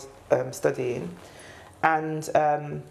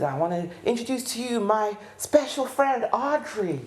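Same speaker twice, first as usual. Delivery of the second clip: a hint of a showman introducing an act, higher; stage announcer; 8 semitones higher than usual. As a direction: present her to the crowd with flair. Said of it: "And he says".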